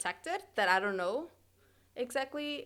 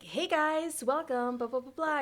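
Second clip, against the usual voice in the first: pitched-up, sing-out commercial greeting; higher commercial voice; 5 semitones higher, slower than usual. Shown as a demonstration. Actor showing commercial delivery to interviewer, higher commercial voice. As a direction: lift the pitch like a commercial host. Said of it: "your voice gets higher".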